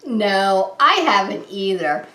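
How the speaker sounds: normal talking voice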